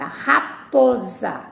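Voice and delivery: drawn out slowly, syllable by syllable; slow syllable demo. Shown as a demonstration. Teacher to pupils, slowly spelling out raposa. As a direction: stretch each syllable of raposa. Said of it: "Observe e analise a seguinte palavra".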